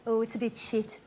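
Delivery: low, soft, deflated Brummie mutter; glum local. Retold or imitated. Imitating a Birmingham local, low and deflated. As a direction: sigh it out like an unimpressed local. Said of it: "they all go".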